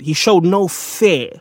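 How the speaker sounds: ordinary talk, nothing marked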